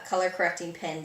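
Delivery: ordinary talk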